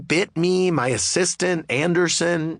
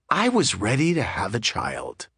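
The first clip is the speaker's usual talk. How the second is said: ordinary delivery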